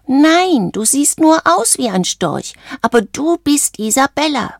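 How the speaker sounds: high-pitched character voice; insistent frog friend. A narrator's reading. Valentino telling Isabella who she is, high and insistent.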